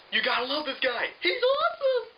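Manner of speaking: squeaky sarcastic cheerleader voice; mock gushing fan. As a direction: gush over him in mock adoration. Mocking the book's gushing tone.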